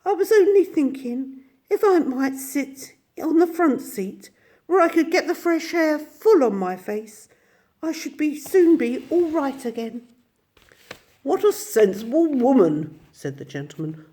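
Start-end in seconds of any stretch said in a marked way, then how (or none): 0.0-10.1 high put-on weak old woman; feeble voice. A narrator's reading. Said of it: "in a feeble voice". 11.2-13.0 high, hearty approval; pleased with her; approving gentleman. A narrator's reading.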